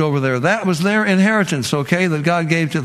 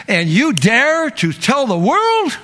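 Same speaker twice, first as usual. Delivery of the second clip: rising, slow, louder indignant challenge; higher, slower, angry accusation; real anger; 7 semitones higher, 3 dB louder, slower than usual. Real, not acted. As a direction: demand it with real outrage. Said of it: "I really get angry".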